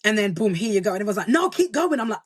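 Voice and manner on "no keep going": higher-pitched urging; high, insistent encouragement; eager encouragement. Retold or imitated. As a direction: imitate fans cheering someone on. Voices audience urging her on.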